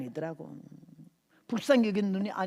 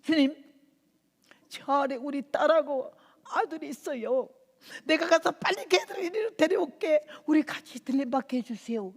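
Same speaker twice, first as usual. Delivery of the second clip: pleading; 6 semitones higher than usual. Retold or imitated. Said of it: "그래서".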